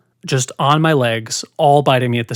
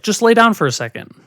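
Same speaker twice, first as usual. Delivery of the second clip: higher-pitched imitation of mom coaxing; mother's coaxing request; 7 semitones higher, 3 dB louder, faster than usual. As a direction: imitate a mother casually coaxing her child. Mother coaxing child, first take.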